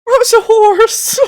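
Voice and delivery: loud, high, drawn-out squeal; real high-pitched shriek of amusement; amused outburst. Real, not acted. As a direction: burst out loudly in delighted disbelief. Bursts out at sight on screen.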